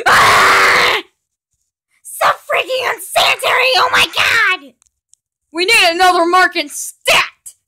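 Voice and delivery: high, loud panicked yelling; panicked shouting. Acted. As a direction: scream it in disgusted panic. Germ panic over sneeze.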